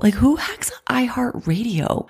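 whispered in disbelief, slow; incredulous whisper. Real, not acted. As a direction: breathe it out in disbelief. Baffled aside about hackers.

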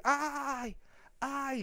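high, drawn-out soft wail; mock scream of pain. Storyteller showing how he screamed all night, mock scream. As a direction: imitate screaming, high but held back in volume. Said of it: "puro grité así".

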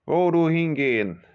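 high, loud broken-German thug; gruff hijacker. Acted. Hijacker questioning the passenger, loud and suspicious. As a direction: bark it like a foreign hijacker.

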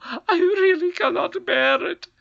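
high, slow, crying squeal; whining goblin queen. A narrator's reading. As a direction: wail it in misery.

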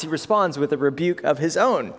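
ordinary delivery

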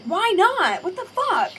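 high, loud outraged dad; imitating an outraged dad, loud and high; freaked-out dad. Dad freaking out about the pharmacy, mock loud outrage. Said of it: "he freaked out".

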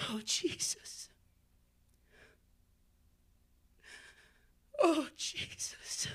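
soft breathy whisper to Jesus; whispered prayer. Real, not acted. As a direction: barely breathe the name in prayer. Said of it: "whispered prayer".

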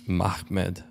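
put-on Mahmoud said slowly with a guttural kh, an Arabic accent; mimicking Arabic name. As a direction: copy his throaty Arabic Mahmoud. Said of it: "You're like".

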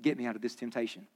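soft, low plea to Jesus; hushed prayer. Shown as a demonstration. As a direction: pray it under your breath. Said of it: "you can say".

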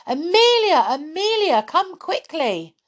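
high, loud call across the room. Acted. Clown calling Amelia out of the cupboard. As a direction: call her urgently to come.

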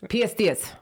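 "pierced ears" as piest ies, R dropped; Rhode Island accent. Shown as a demonstration. Reading the local spelling aloud, Rhode Island accent. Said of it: "spelled P S D S".

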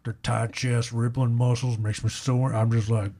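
put-on old cowboy voice; comic cowboy actor impression, drifting as it goes; Sam Elliott. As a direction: grumble like a western movie star. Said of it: "That started as a Sam Elliott impression".

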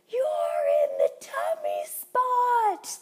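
high, drawn-out mock child scream; imitating an outraged three-year-old's shriek; screaming toddler. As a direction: wail it loudly at the top of your lungs. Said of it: "my three-year-old screaming".

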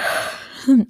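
long breathy yawn; yawn sigh. Real, not acted. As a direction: let out a yawn. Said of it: "I gotta yawn".

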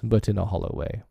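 plain reading voice

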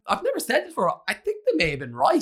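high, loud laughing confession; laughing admission. Real, not acted. Admitting to the host sisters were right, high laughing.

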